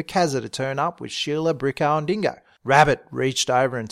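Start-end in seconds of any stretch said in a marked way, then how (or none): none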